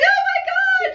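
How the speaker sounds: very high, loud excited squeal; delighted shriek. Retold or imitated. Medium mimicking a client thrilled her cats came through. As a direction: shriek it with joy, over the top. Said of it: "She was like".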